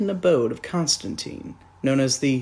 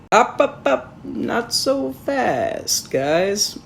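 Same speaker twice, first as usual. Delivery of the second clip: higher, slowed mock Batman; 6 semitones higher, much slower than usual. Reviewer mocks Batman flying off, higher and slow. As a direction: drawl it out as smug Batman.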